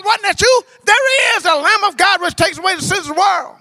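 high, loud impassioned preaching; loud fervent sermon climax. Acted. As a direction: press John with each question. Preaching at full force.

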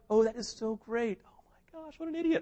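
high, dismissive mock praise; mock condescension. Siblings brushing off little Lucy, high mock condescension. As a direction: pat someone on the head with your voice. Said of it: "You've ever done this to somebody?".

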